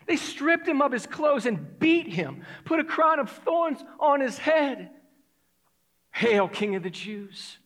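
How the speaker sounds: loud, high, building sermon delivery; fervent preaching. Real, not acted. Preacher recounting Jesus mocked and pinned to a cross, fervent preaching. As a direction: pound out each suffering, peaking on the silence. Preaches the crucifixion at full force.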